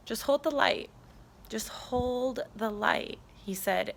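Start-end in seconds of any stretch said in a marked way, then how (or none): 1.5-3.2 slow, emphatic repeat. Retold or imitated. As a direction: HOLD stretched out slowly. Same message repeated, drawn out.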